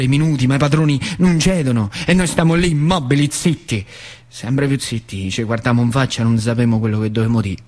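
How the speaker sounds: ordinary talk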